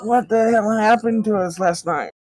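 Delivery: a goblin nursing a headache after a rough night; hungover goblin. Acted. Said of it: "another goblin kinda, like, rubbing his head".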